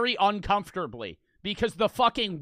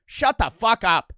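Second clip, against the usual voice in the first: loud, slower furious shout; angry yell; 4 semitones higher, 7 dB louder, slower than usual. Real, not acted. Streamer to complaining viewers, loud angry yell. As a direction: spit each word at chat. Yelling at chat again.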